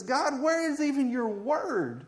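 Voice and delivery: high, slow challenge to God; prophet questioning God. Acted. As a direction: plead upward, drawing the words out. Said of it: "he says".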